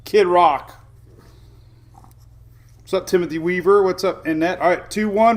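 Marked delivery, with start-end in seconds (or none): none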